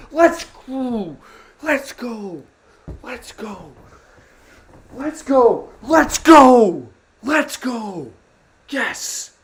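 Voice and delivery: high whooping cheers, over and over; celebrating shouts. Real, not acted. Fan cheering the win to his viewers, jubilant shouts. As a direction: cheer like your team just won.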